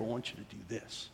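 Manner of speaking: hushed, intimate instruction; quiet word from a mentor; imitated whisper. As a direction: drop to a very soft voice. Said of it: "in a whisper".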